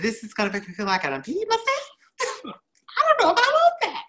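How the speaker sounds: high laughing disbelief. Retold or imitated. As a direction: laugh through the words in disbelief. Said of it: "I said".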